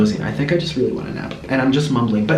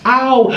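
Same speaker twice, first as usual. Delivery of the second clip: sudden high yelp of pain, much higher and louder; sharp ouch; 10 semitones higher, 5 dB louder than usual. Real, not acted. Bitten by her parrot, a real high yelp of pain. Parrot bites during tail show.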